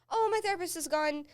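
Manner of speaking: ordinary talk, nothing marked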